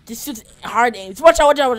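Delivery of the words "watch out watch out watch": shouted, urgent warning; loud warning. Real, not acted. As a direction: yell it to warn them. Warning teammate loudly.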